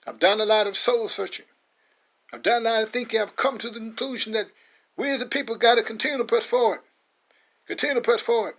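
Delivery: a poet rallying his people from the stage; rousing speech. Acted. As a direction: deliver it like a proud public address. Max addresses crowd in speech.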